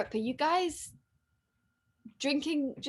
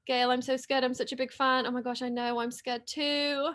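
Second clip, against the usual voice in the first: ordinary talk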